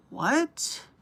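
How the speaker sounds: retold reaction of stunned disbelief; shocked. Woman retelling her reply to a hookup offer, shocked. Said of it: "I was so shocked".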